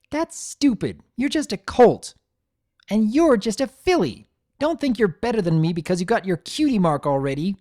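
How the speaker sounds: high, loud, sneering, speeding up; angry sibling. A narrator's reading. Quarreling foals insulting each other, high and loud. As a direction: tear into your sibling.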